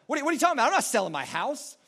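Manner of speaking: acted parental anger; getting mad. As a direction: burst out in mock outrage. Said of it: "starts getting mad".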